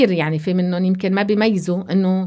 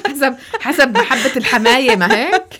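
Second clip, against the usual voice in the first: laughing; 5 semitones higher, 3 dB louder than usual. Real, not acted. Laughing at joke about in-laws.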